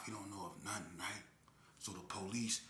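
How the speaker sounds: ordinary delivery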